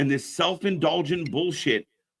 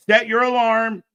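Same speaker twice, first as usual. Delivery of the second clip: louder repeat; 6 semitones higher, 5 dB louder than usual. Real, not acted. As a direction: Set punched louder and higher, the whole phrase "Set your alarm" pushed out as an emphatic order.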